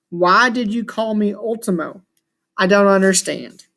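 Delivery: usual speaking voice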